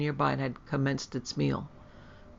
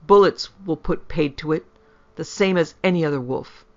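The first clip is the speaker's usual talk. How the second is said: louder, angry insistence; choking with rage; 7 dB louder than usual. A narrator's reading. Lecouteur insists bullets will stop the beast, raging. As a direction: argue back forcefully, punching the first words. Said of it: "choking with rage".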